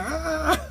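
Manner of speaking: high cry of pain as the finger bends; pained yelp. Retold or imitated. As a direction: yelp as if the finger is bent back. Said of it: "I'm like".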